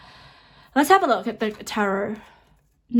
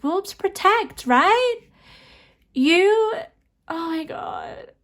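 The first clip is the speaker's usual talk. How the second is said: overcome with excitement; 7 semitones higher, much slower than usual. Real, not acted. Overwhelmed by the reading.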